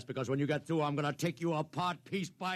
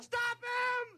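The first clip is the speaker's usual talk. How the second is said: desperate shout. Acted. Desperate order over the phone.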